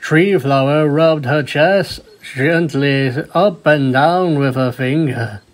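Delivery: usual speaking voice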